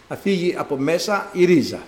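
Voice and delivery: normal talking voice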